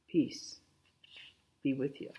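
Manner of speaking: softly whispered peace; preacher voicing Jesus, whispering peace to the congregation; whispered blessing.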